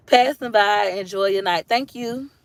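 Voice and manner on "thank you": ordinary talk, nothing marked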